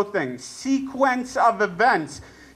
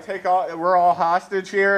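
ordinary talk

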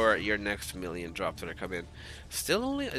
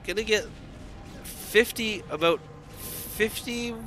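caster getting carried away by the big shot; excited casting; 10 semitones higher than usual. Real, not acted. Caster hyped by big tank shot.

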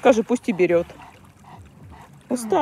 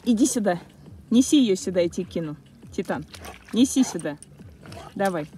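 normal talking voice

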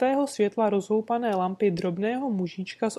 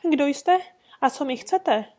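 trembling voice; 5 semitones higher, 4 dB louder than usual. Acted. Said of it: "zeptal se třesoucím se hlasem".

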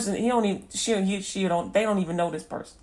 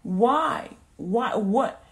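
pleading questions, raised a little; crying out to God; 4 semitones higher, 4 dB louder, much slower than usual. Retold or imitated. Hurt believer crying out to God, imitated plea. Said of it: "you're crying out to God".

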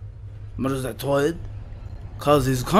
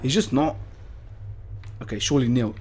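normal talking voice

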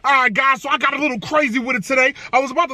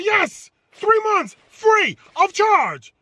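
slow, higher sweet confirmation; imitating manager; 8 semitones higher, much slower than usual. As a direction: savour each word of the deal.

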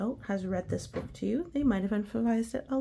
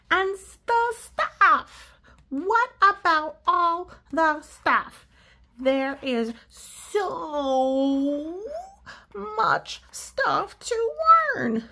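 higher, louder, very slow pigeon voice; overwhelmed pigeon; 8 semitones higher, 8 dB louder, much slower than usual. Acted. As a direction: stretch out so much in alarm. Pigeon panicking.

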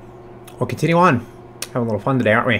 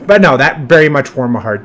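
ordinary talk

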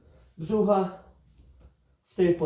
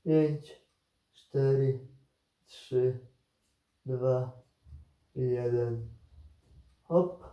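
counting down to go; slower than usual. Acted. Coach counts down a timed hold.

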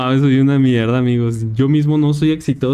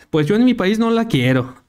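voz más aguda y desdeñosa; recházala con desprecio; funcionario gringo; 7 semitones higher than usual. Retold or imitated. Funcionario gringo rechaza a la Gori. (funcionario de visas desdeñoso)

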